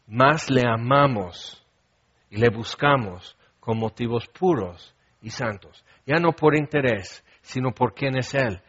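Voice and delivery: usual speaking voice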